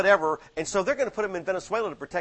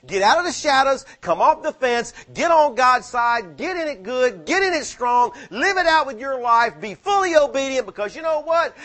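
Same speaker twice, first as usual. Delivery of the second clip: louder, higher, hammering each phrase; preaching at full force; 7 semitones higher, 6 dB louder than usual. Acted. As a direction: thunder it at the congregation. Preacher exhorts the congregation.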